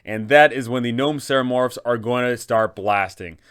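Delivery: ordinary talk, nothing marked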